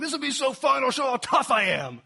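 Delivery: high, loud, hammed-up gung-ho excitement; over-the-top fake enthusiasm; mock bravado. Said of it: "He's not like".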